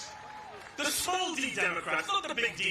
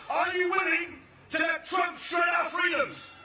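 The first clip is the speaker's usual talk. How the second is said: higher, louder rally shout; louder rally question; 7 semitones higher than usual. Acted.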